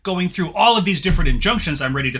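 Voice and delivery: loud, high, pompous lawyer demanding his client; blustering lawyer. Acted. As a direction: bellow it like an indignant attorney. Lawyer threatens injunctions.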